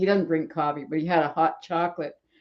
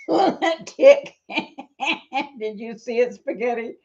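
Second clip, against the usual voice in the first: laughing; 6 semitones higher than usual. Real, not acted. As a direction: crack up at her own pun. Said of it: "my name is Luna".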